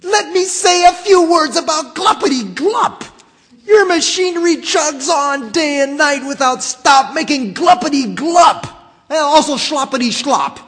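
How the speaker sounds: high, loud, indignant shouting; angry Lorax. Acted. Lorax scolding the Onceler about Gluppity Glup, high angry shouting. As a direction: rail at him high and loud with dander up. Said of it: "snapped the Lorax, his dander was up".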